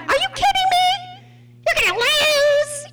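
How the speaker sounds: high, mocking disbelief; incredulous classmates. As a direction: scoff at him like shocked kids. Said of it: "they thought I was out of my mind".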